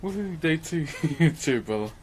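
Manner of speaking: ordinary talk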